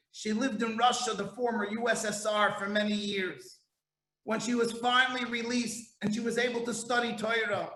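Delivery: ordinary talk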